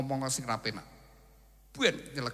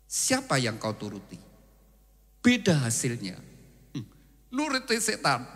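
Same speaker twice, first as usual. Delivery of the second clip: ordinary talk, nothing marked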